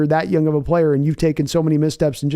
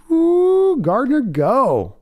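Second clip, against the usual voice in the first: excited cheer; 10 semitones higher, much slower than usual. Real, not acted. Cheering on a quarterback.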